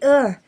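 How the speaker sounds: loud, high disgusted groan; a real groan of revulsion. Real, not acted. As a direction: let out a pained ugh of disgust. Disgust at the book.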